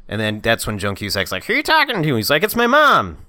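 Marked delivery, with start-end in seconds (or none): 1.5-2.1 high-pitched nagging wife voice; suspicious wife. Retold or imitated. Retelling the wife questioning her husband, suspicious. 2.4-3.2 high, loud mock-innocent excuse; cornered husband. Retelling the husband fibbing to his wife, mock innocent.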